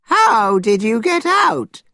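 put-on astonished old man's high, slow question; old man voiced in amazement; great surprise. A narrator's reading. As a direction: ask it high and drawn out, amazed. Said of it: "said the old man in great surprise".

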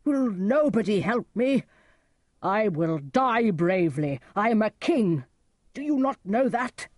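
loud, defiant king's outburst; crying out. A narrator's reading. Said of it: "cried Lear".

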